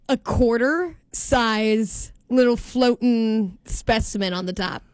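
usual speaking voice

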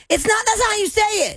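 high-pitched mock indignation; mock outraged texter. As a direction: squawk it like an indignant complainer. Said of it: "one text that says".

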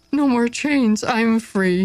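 high, joyful shout of freedom. A narrator's reading. Marcus shouting his joy at being free. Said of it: "he shouted".

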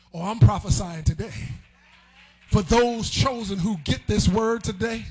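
loud, driving sermon delivery; full-force preaching. Real, not acted. Preacher prophesying to the chosen, full-force preaching. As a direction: preach it hard with shouted peaks.

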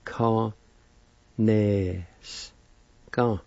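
"Ka, ne, s, ka" each syllable split and spoken apart. Shown as a demonstration. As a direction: break "Kaneska" into separate syllables. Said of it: "First part is pronounced like this".

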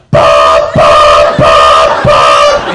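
loud, low blaring buzzer noise; harsh mechanical beeps by mouth; imitated alarm clock. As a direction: honk it out like an alarm, evenly repeated. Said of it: "And she goes".

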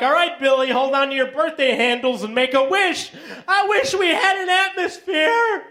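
sing-song birthday announcer, mock cheerful; space party parent. Parent cheering a space birthday, cheery. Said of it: "It's like".

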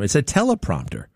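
usual speaking voice

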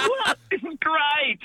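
high, delighted disbelief; surprised young self. Retold or imitated. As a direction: squeak out the excitement of a young man. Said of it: "And I'm like".

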